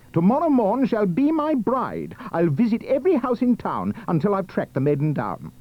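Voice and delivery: high declaration settling a little; ardent cry. Acted. Prince vowing to find his bride, crying out. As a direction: declare it like a royal vow. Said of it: "he cried".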